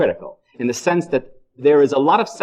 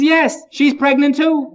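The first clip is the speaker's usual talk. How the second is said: put-on much higher, a little louder old man; an old man showing off his news; proud old groom; 11 semitones higher, 3 dB louder, slower than usual. Old groom answering the doctor.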